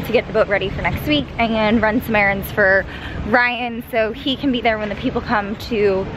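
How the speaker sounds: ordinary delivery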